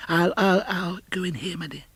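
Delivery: put-on high, halting old man; nervous stutter, tiptoeing in; stammering Scrooge. Scrooge timidly edging into his nephew's dining room.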